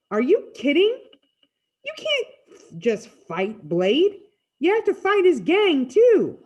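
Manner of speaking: loud, high-pitched disbelief; emphatic, disbelieving outburst; incredulous protest. Acted. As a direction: protest loudly, rising to a high finish. Narrator's incredulous reply to friend.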